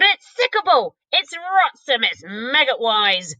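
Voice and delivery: disgusted giant. Acted. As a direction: giant revolted by his food. BFG voicing disgust.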